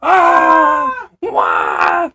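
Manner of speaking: hammed-up high, loud panicked shriek; screaming skeletons. Imitating skeletons getting blown up, screaming. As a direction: wail like a cartoon skeleton under attack. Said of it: "they're all screaming".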